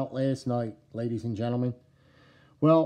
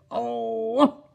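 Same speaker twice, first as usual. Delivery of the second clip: excited yelp; 8 semitones higher than usual. Retold or imitated. Excited yelp at knife reveal.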